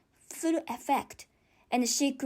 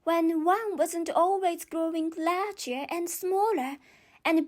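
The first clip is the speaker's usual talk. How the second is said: put-on higher girlish musing, wondering; Alice's voice; 4 semitones higher than usual. Alice ponders her fairy tale life, girlish wonder. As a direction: muse aloud like a curious child.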